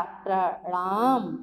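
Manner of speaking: प्रणाम drawn out slowly, r right after प; slow careful pronunciation. Shown as a demonstration. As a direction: sound out प्रणाम slowly for children. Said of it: "रा का उच्चारण आएगा".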